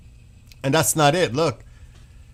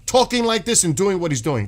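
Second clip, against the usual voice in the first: usual speaking voice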